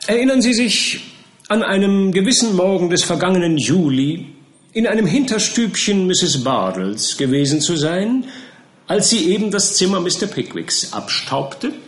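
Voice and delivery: high, loud questioning lawyer; pompous prosecutor. A narrator's reading. Prosecutor Buzfuz questions the charwoman, high and pompous. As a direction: press the witness with theatrical gravity.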